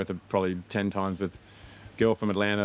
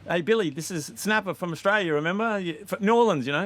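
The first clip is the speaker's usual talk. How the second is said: hyped-up filmmaker; 10 semitones higher than usual. Retold or imitated. Phoning Billy months later.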